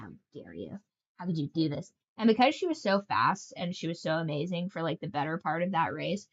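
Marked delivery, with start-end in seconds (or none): 0.0-1.9 much softer, lower mock-anguished lament; hammed-up heartbreak, almost under the breath; mock-tragic how dare you; mock betrayal. Gambler betrayed by her horse.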